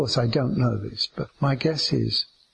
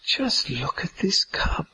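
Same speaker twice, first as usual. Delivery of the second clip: slow, awed whisper; hushed amazement, drawn out; whispered wonder; 3 semitones higher than usual. Acted. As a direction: whisper as if marvelling at a small object. Acting wonder at a cup.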